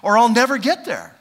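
urgent pleading prayer. Retold or imitated. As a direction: cry out to God for help. Preacher voicing his own prayer.

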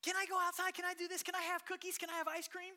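put-on high, loud pleading child voice; playful kid impression; eager little kid. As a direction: beg like a kid asking for treats. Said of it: "they're doing what?".

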